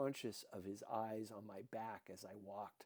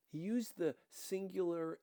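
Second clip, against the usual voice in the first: plain reading voice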